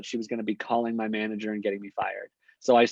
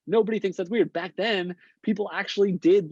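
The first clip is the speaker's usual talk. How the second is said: emphatic; 8 semitones higher than usual. Real, not acted. Insisting transparency is normal now.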